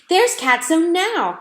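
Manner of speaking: high, loud and slow alarm; crying out. A narrator's reading. Girl crying out at the sight of the cat. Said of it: "cried Lana".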